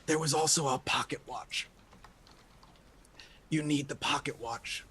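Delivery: quiet, weak voice with long pauses; wounded man. Acted. Wounded man tells the group what they need, weakly. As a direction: spend little breath, softly and haltingly. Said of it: "And he's like".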